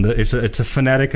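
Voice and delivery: ordinary talk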